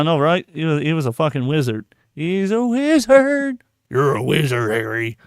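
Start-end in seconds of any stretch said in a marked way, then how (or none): 2.1-5.2 Hagrid voice. Retold or imitated. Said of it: "I don't think I did the voice right".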